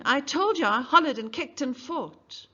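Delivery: high, loud exasperated cry; shouting back. Acted. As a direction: yell it at the lawyer. Mayella shouting under cross-examination.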